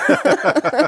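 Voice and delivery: bright real laugh. Real, not acted. Laughing at a friend's quote. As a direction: burst out laughing at the joke.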